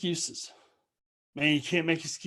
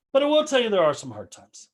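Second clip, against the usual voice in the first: ordinary talk, nothing marked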